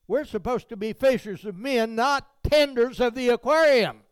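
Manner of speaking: loud, high preaching climb; fiery preaching. Acted. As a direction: preach it out to the congregation. Preaching at full force.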